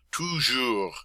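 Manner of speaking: toujours with a laugh and throaty r; laughing French r. Shown as a demonstration. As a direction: give the R in toujours a good roll. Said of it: "give that R sound a good".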